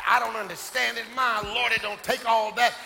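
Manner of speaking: low, soft, halting grumble; mock muttered griping. Preacher imitating a member puzzled by the singing, mock muttering. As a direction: play a baffled complainer muttering in the pew.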